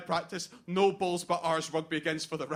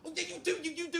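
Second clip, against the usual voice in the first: taunting; 10 semitones higher, 4 dB quieter, much faster than usual. Acted. Taunting Angela over her saying.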